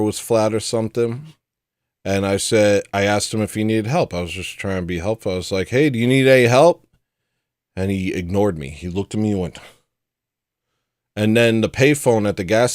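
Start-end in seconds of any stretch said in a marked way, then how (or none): 5.7-6.9 raised, friendly call across the lot. Retold or imitated. To an upset stranger at the gas station, a raised friendly call. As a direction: call out an offer of help, louder.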